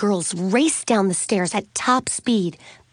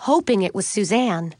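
plain reading voice

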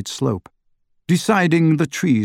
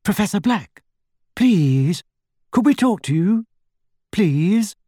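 high, polite entreaty; pleading gently, pitched up; coaxing plea; 8 semitones higher than usual. A narrator's reading. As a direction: coax someone out with a courteous request. Coaxing the absent portrait to appear.

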